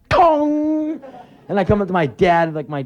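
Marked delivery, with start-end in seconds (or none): 0.1-1.0 high ringing "tong"; loud drawn-out impact noise; imitated pipe clang. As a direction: let it ring like metal on teeth. Imitates lead pipe hit.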